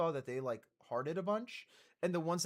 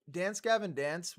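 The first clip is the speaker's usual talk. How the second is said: usual speaking voice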